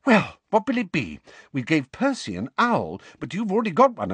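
high, excited breathless mother. A narrator's reading. Mother letting go of her son after hugging him, breathless. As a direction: gush out of breath, pitched high. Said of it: "said breathlessly".

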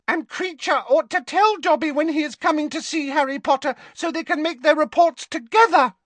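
a small elf telling off the other elf; squeaky elf. A narrator's reading. Dobby scolds Creature.

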